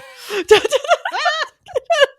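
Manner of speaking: squealing high laugh; shrieking laugh; high giggle. Real, not acted. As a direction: giggle in delight. Laughing after son's plea.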